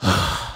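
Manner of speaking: heavy imitated sigh; big breathy sigh; deep breath. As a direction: act out a long exhale to calm down. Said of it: "he take a deep breath".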